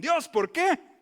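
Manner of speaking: loud, high whining protest; the preacher mimics the people's complaint to God, loud and high; complaining why. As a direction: throw the question back at God.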